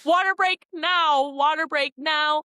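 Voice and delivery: high, loud drawn-out call; insistent high-pitched holler. Acted. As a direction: call it out loud, stretching each word. Acted call for a break.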